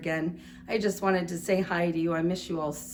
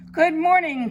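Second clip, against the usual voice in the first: much higher, louder cheerful duck greeting; polite duck quack; 10 semitones higher, 10 dB louder than usual. Acted. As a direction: chirp it out like a courteous drake. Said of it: "quacked Mister Mallard".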